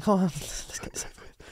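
hushed whisper with a laugh; barely audible, resigned; reluctant aside. Retold or imitated. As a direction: mutter it, wanting it over. Grudging aside to the fan.

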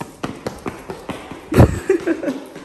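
high breathy laugh; a light airy chuckle. Real, not acted. Laughs at own vlog.

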